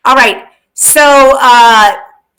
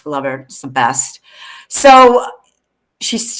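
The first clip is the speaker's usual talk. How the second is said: usual speaking voice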